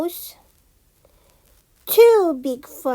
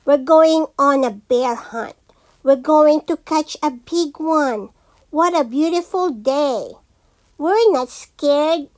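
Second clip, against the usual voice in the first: ordinary delivery, nothing marked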